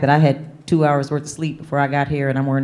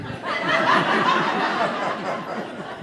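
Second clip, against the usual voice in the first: soft laugh; 3 semitones higher, 5 dB quieter than usual. Real, not acted. Laughing at her lost luggage.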